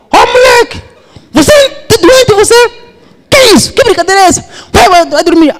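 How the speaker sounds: very high, loud yelling with a laugh; furious woken father. Acted. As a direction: rage at being woken in the night.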